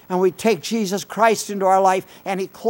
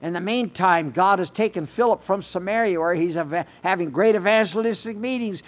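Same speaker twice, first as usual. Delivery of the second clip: ordinary talk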